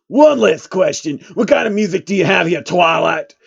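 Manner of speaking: loud, quick question; eager rapper. Acted. Lil Jon quizzing Twilight, loud and quick. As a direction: fire off the question fast.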